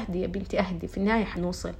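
soft, gentle calming of a friend; soothing calm. Retold or imitated. Telling her stressed friend to calm down, soft and soothing. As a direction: hush her down softly, unbothered. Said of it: "قلت لها".

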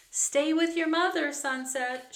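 high, loud farewell shout. A narrator's reading. Annie shouting goodbye to the young mustang, loud high yell. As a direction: yell it out to someone far off. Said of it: "shouted Annie".

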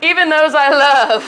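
mock lecture. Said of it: "being like".

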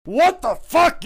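high, loud disbelief; outraged Vince. Acted. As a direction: explode at the news. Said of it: "Vince's like".

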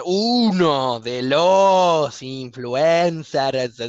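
exaggerated, stretched-out words; very slow. Professor restating the question to student, hugely drawn out. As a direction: drag each word out with laboured patience.

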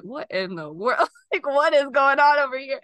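imitating bewildered kids. Kids reacting to her sobbing at work, imitated bewilderment. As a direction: exclaim it in laughing disbelief, as a quote.

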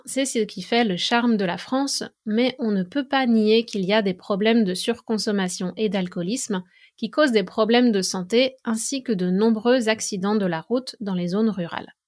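usual speaking voice

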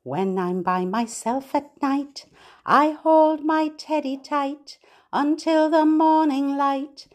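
bright sing-song rhyme for babies; nursery rhyme reading. Acted. Reading a rhyme to babies, sing-song. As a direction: read it lilting, to a little one.

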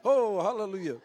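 high, loud, coming out of a big laugh; laughing exclamation. Real, not acted.

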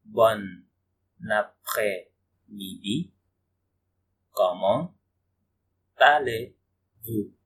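après-midi and allez-vous stretched very slowly with pauses; very slow, deliberate model pronunciation; slow teaching demo. Shown as a demonstration. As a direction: spell it out slowly for beginners. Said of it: "teacher repeats phrase slowly".

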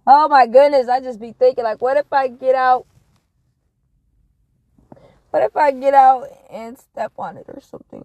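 high loud fretting; anxious loud worry. Real, not acted. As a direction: fret out loud, panicky. Anxious about stepping out.

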